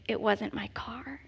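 hushed slow confession; confiding whisper. Real, not acted. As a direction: drop to a whisper to let them in on it. Confessing a damning detail.

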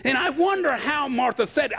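normal talking voice

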